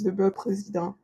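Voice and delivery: "beurre président" in native French, soft final syllable; French pronunciation. Real, not acted. Naming French butter brand.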